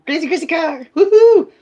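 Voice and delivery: very high, zany cheer; hammed-up high-pitched silliness; playful craziness. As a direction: go giddy and squeaky, then whoop. Hamming up being crazy.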